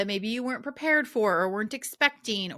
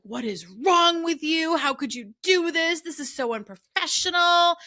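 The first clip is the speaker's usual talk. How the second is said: a mock harsh scolding, pitched higher and louder; berating herself; 9 semitones higher, 5 dB louder than usual. Coach retelling how she berated herself over the missed session, in a mock harsh scold. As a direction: tear into yourself in a retold self-scolding. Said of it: "berating myself".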